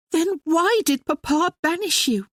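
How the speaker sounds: Maria crying out. Acted. Sister demanding answers from her brother, high pleading cry. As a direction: cry it out as a hurt younger sister. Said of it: "Maria cried".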